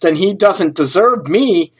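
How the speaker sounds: normal talking voice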